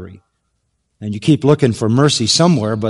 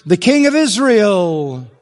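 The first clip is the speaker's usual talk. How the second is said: slow, higher, sneering mock-reverence; sarcastic title; 6 semitones higher, slower than usual. Preacher voicing the Sanhedrin's mock title, sneering. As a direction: say the title as a scornful joke. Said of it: "You can see the sarcasm there".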